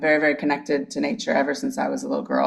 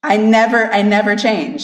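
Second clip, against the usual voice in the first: NEVER hit higher and louder; emphatic retelling; 6 semitones higher, 6 dB louder than usual. Retold or imitated. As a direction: stress "never" hard each time, raised and insistent. Retelling mom's account, insisting.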